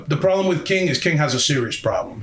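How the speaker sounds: ordinary talk, nothing marked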